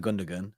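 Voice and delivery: Gundogan as "GUN-duh-gun"; common mispronunciation. Retold or imitated. Quoting what is heard all the time, mispronounced. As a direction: sound both g's in Gundogan. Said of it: "I see that all the time".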